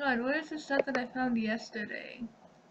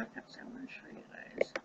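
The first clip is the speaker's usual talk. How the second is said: whispering; 9 dB quieter than usual. Real, not acted. Whispered aside.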